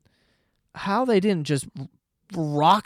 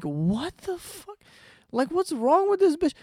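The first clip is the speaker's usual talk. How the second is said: very high, stretched-out disbelief; incredulous reaction; 13 semitones higher than usual. Retold or imitated. Watching girl abuse train passengers, high-pitched incredulity. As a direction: squeak out your appalled bafflement.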